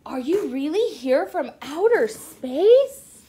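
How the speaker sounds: an excited dinosaur meeting a spaceman. Acted. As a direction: gush at the spaceman in awe. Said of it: "Rex the dinosaur got very excited".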